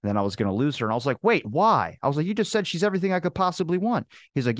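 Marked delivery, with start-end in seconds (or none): 1.2-2.0 raised pitch, retold disbelief; baffled objection, acted out; incredulous protest. 2.3-4.1 high-pitched retold objection; acted-out indignant bafflement; incredulous protest.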